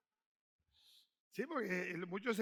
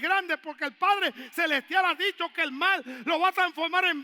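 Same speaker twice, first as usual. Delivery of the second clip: loud, high preaching shout; fervent preaching; 6 semitones higher, 8 dB louder than usual. Acted. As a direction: preach it out to the congregation. Preaching at full force. (predicación a gritos)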